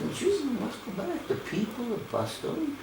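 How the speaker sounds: high, soft imitation of a Belgian woman's accent; Belgian woman's heavy accent. Veteran retells Belgian woman urging his return, lightly imitated accent, high and soft. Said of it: "had a, a heavy accent".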